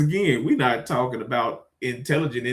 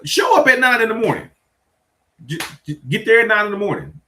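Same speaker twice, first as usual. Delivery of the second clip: exasperated instruction; 8 semitones higher, 6 dB louder, faster than usual. Real, not acted.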